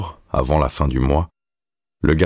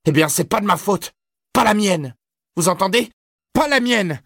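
put-on very high, frantic boy's protest; agitated outburst at the top of the voice; venomous denial. A narrator's reading. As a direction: insist angrily, climbing to a piercing pitch. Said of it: "cracha Todd, venimeux".

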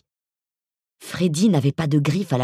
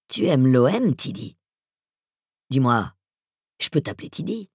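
voice from beyond the grave. Acted. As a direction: speak like a menacing ghoul. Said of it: "sa voix d'outre-tombe".

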